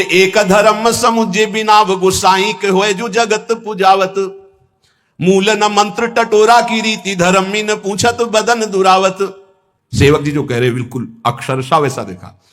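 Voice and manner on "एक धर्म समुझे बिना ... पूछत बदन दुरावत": higher, slow melodic recitation; slow, higher sung-speech verse. Acted. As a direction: lift the verse slowly like a hymn.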